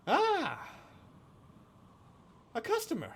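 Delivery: high, sly gnome merchant voice; sly shopkeeper. Acted. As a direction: greet the customer like a sly little gnome.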